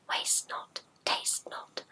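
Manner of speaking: almost silent whisper; voiceless hushed chant. Shown as a demonstration. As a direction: barely breathe it. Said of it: "Are you ready?".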